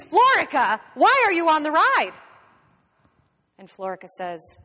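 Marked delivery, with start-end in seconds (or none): 0.1-2.1 loud, high yell at an underling; angry scream. A narrator's reading. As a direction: scream the accusation. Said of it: "the inspector screams".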